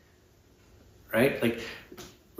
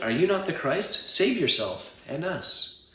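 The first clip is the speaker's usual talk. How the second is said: high-pitched challenge, drawn out at the end; taunting criminal, read aloud; railing; 8 semitones higher than usual. A narrator's reading. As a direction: rail at him in a raised pitch. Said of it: "railed at him".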